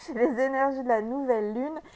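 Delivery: bursting with new moon energy, laughing into it; real excitement, pumped and loud; fired up. Real, not acted. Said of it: "vous devez sentir à ma voix".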